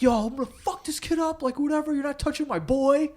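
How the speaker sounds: high-pitched mock yell of a hammered friend; imitating drunk friend yelling. Drunk friend yelling he'll fight the kid for him, high mock yell. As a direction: raise the pitch and rant like a drunk buddy. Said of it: "screaming or whatever".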